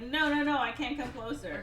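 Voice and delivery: high, flustered refusal; mock panic. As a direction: fend her off in comic alarm.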